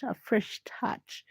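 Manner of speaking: slow breathy whisper. Shown as a demonstration. Repeats phrase, second take whispered.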